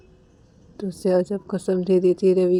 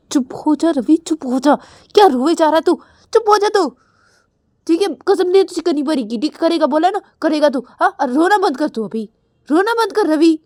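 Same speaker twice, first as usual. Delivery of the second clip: higher, louder, sharp scolding; 6 semitones higher, 4 dB louder, faster than usual. Retold or imitated. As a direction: tell him off to stop crying. Shreya scolds crying Ravi. (डाँटते हुए, ऊँची तेज़ आवाज़ में)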